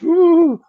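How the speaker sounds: high, drawn-out cold "ooh"; shivery ooh. Retold or imitated. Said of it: "I've been very cold".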